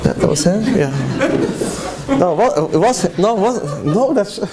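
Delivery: high, startled stammer of onlookers; shocked whispering neighbors. Retold or imitated. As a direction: play the gawking neighbors, caught off guard. Acting out neighbors' shocked faces.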